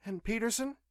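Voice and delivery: high female question, rising; prompting woman. A narrator's reading. Nurse prompts the pilot for news, questioning. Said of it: "she prompted".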